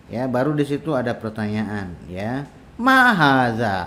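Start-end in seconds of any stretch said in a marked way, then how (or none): 2.8-3.9 Ma haza read slowly, louder, as a model for the class; a teacher's slow model of ma haza. Shown as a demonstration. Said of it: "apa ini?".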